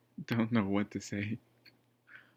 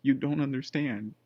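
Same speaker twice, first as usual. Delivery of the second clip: insistent protest; 5 semitones higher, 3 dB louder than usual. Retold or imitated. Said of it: "And I'm like".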